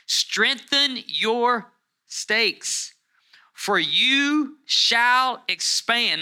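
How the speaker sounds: high, loud, stretched words with breaks between; forceful scripture proclamation. Acted. Preacher urging the congregation through the verse, emphatic proclamation. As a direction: drive each command home slowly.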